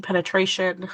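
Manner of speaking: usual speaking voice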